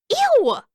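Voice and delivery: high, loud squeal of disgust. A narrator's reading. Katara reacts to her brother's crude remark, disgusted.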